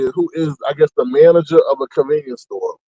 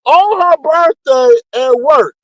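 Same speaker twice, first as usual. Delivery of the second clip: normal talking voice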